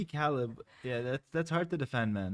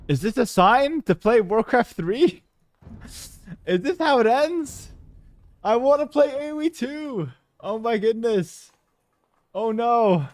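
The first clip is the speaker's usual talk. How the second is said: much higher, louder cry of dismay at the game; half-comic panic, high and loud; dismayed outcry; 6 semitones higher, 9 dB louder than usual. Real, not acted. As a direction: wail it out, losing hope it will work.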